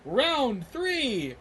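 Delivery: high, stretched-out ring call; arena announcer. Acted. Announcer calls the next arena round to the crowd, booming. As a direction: draw out each word like a fight announcer. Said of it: "the announcer takes the horn back and says".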